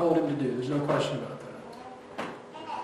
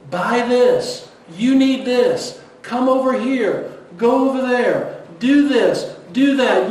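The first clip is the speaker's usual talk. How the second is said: loud, higher, pushy demands; world shouting; 5 semitones higher, 6 dB louder than usual. Retold or imitated. The world shouting demands at believers, loud and high. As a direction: bark the commands like a pushy crowd. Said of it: "the world shouts at us every single day".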